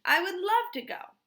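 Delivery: high, loud eager voice. A narrator's reading. Stanley answering the invitation, high and eager. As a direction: bubble over with delight. Stanley excited about trip.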